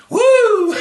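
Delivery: a big high whoop of glee; excited whoop. Real, not acted. Teacher whooping at the flying grandma, high and loud. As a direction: whoop with delight at the idea.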